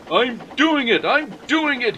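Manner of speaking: a straining engine panting. Acted. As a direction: gasp it out mid-climb. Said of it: "he panted".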